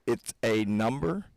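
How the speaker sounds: usual speaking voice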